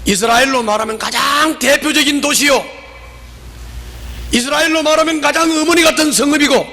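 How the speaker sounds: high-pitched, impassioned challenge; forceful remonstrance. Acted. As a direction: press the rebuke home in rising preaching cadence. Preacher voices the woman's challenge. (높은 음성으로 힘주어 따짐)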